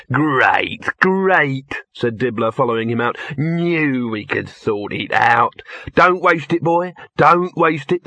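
huckster. A narrator's reading. Dibbler voiced by narrator.

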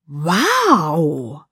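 "Wow" stretched into three syllables; drawn-out Wow. A narrator's reading. Amazed friend hearing the news, "Wow" stretched to three syllables. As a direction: pull "Wow" slowly apart, swooping up and down. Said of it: "zog das Wort zu drei Silben auseinander".

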